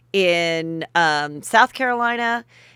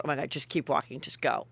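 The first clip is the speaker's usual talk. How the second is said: low, soft aside to a friend; hushed urging; 6 semitones lower, 9 dB quieter than usual. Retold or imitated. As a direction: imitate a friend quietly urging you onward. Friend urging her to move on.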